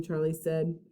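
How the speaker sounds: ordinary delivery, nothing marked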